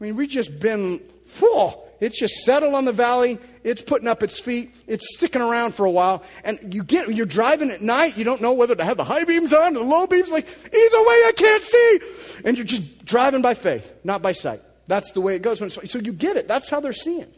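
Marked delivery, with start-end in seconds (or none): 1.4-10.3 loud, driving preacher voice; preaching louder. Acted. Preacher scolding modern views of Jesus, loud and driving. 10.3-12.0 exasperated cry. Retold or imitated. Fog driving, exasperated. 12.5-17.3 loud, driving preacher voice; preaching louder. Acted. Preacher scolding modern views of Jesus, loud and driving.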